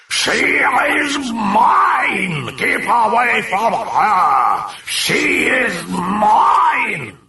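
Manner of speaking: very slow, high, roaring demon cry; slow coarse demonic bellow. Acted. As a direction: bellow it with monstrous power. Demon claims the girl.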